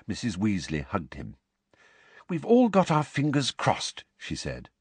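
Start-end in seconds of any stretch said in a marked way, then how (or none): 2.3-4.0 motherly voice. Acted. Mrs Weasley voiced.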